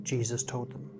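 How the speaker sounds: plain reading voice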